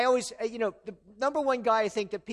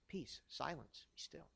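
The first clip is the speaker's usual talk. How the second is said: calming command; 5 semitones lower, 18 dB quieter than usual. Acted. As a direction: hush the storm, almost whispered. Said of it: "What did Jesus say to the storm?".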